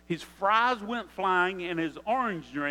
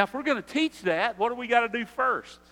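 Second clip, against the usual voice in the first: ordinary delivery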